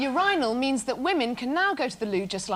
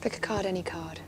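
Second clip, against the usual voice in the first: fortune teller; 4 semitones lower, 3 dB quieter than usual. Pretend. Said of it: "Madame Didi for a day".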